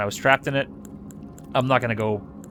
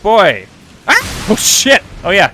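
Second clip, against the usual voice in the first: excited yelling; 10 semitones higher, 10 dB louder, slower than usual. Real, not acted. Fighting enemies in game.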